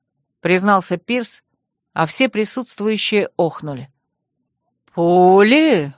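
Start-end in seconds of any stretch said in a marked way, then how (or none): 4.9-5.9 louder, slow ominous drawn-out word; ominous drawl. Acted. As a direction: stretch it out menacingly. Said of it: "зловеще протянул Лер Дюршак".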